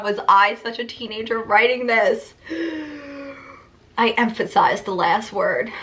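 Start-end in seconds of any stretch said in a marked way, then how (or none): 2.5-3.5 gasp. Real, not acted. Cringing at own writing.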